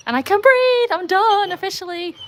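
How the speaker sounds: high, loud burst of relief; relieved exclaim. Real, not acted. Finished at last, she exclaims her relief high and loud. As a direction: let it out like a weight has been lifted.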